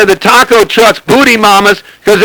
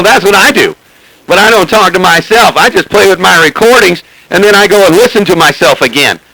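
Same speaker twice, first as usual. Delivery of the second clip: ordinary talk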